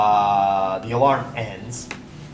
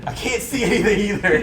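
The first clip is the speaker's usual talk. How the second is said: lightly voiced husband, pitched up; husband calling out; 7 semitones higher than usual. Game master plays the husband in the dark, calling out. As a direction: call back higher, not shouting. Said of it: "the husband".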